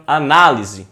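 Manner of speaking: "análise" with a loud, stretched NÁ; stress on NÁ. Shown as a demonstration. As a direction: punch the second syllable of "análise" hard. Demonstrates the stressed syllable by calling.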